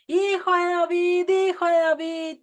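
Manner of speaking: high, loud pleading cry. Retold or imitated. Desperate woman crying out to Yeshua, loud high plea. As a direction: call out desperately from far away. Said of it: "una mujer que empieza a gritar".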